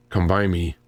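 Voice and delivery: ordinary delivery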